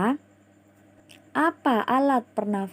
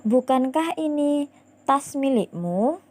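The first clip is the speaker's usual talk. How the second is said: "Bukankah" asked with a higher confirming lift; confirming question; 6 semitones higher than usual. Shown as a demonstration. Teacher demonstrating a bukan question to pupils, questioning tone. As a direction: ask as if expecting yes. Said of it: "Misalnya seperti ini".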